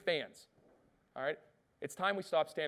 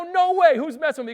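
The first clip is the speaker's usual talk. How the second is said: much higher and louder, near a yelp; stunned disbelief; 12 semitones higher, 12 dB louder than usual. Retold or imitated. Speaker reliving the shock of the call, high yelping disbelief. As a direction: act floored that a hero is on the phone. Retold disbelief at famous caller.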